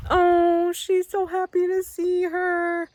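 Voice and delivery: high, slow, tender coo. Real, not acted. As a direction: coo over the reunited calf. Cooing at cow and calf.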